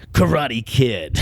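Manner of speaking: usual speaking voice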